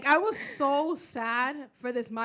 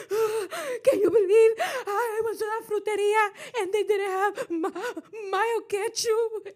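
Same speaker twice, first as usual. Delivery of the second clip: high, loud, slow, whiny crying plea; mock crying; 10 semitones higher, 6 dB louder, slower than usual. Crying to Abby on the phone about no mayo quechu, high and slow. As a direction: wail it through fake tears. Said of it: "So I start crying and I call Abby".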